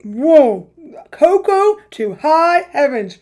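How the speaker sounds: loud startled whoa; surprised exclamation. Real, not acted. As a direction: blurt it out, taken aback. Strong cocoa taste.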